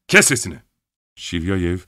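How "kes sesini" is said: sharp loud command; loud shout. Acted. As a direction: cut him off with a shout. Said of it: "öylesine yüksek sesle söylemişti".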